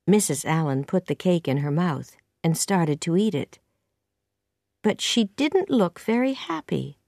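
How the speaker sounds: ordinary delivery, nothing marked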